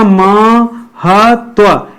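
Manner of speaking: महत्व drawn out very slowly, each sound clear; teacher slowly sounding out महत्व; careful spelling-out. Shown as a demonstration. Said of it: "ये देखिए महत्व में आप ध्यान दीजिएगा".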